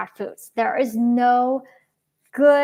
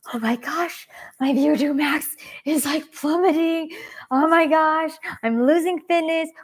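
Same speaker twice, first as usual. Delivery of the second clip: acted-out panic, higher pitch; freaking out; 5 semitones higher, 3 dB louder than usual. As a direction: replay your own alarmed inner voice. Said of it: "I'm freaking out".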